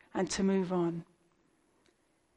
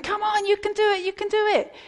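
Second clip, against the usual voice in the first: high-pitched sports-day cheer; cheering parent; 12 semitones higher, 4 dB louder than usual. Acted. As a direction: urge a child on from the sidelines like an excited parent. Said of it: "parents are jumping up and down saying".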